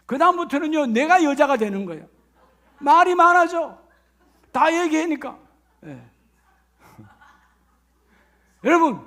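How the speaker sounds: ordinary talk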